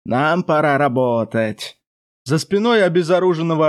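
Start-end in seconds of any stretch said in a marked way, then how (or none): 0.0-1.8 slow, low otherworldly demon voice; a demon speaking inside the mind; demon voice in head. Acted. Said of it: "зазвучал в голове голос демона".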